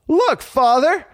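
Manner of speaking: put-on high-pitched boyish call for attention; excited puppet boy. A narrator's reading. Pinocchio showing his father a surprise, excited. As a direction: call him over, high and eager.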